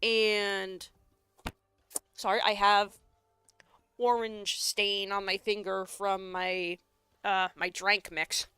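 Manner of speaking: ordinary delivery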